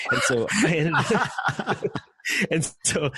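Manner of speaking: laughing through the words; giggling, high and drawn out. Real, not acted. Recalling meeting Bill, laughing as he talks.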